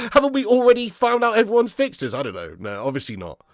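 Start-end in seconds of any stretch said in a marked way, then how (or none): 0.1-2.1 high and louder, in a put-on incredulous voice; exasperated mock question. Real, not acted. Streamer asking chat if fixtures are out, mock disbelief. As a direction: overplay the disbelief, stretching it out.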